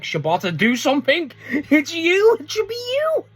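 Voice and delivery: pleading with Shibata. Retold or imitated. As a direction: beg Shibata to step in, voice climbing. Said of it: "and I'm like".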